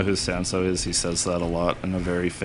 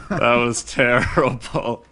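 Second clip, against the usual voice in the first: laughing; 4 semitones higher, slower than usual. Real, not acted. Laughs at failed skip.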